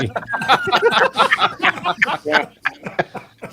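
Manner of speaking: high, loud burst of laughter; big laugh. Real, not acted. Laughing at own joke.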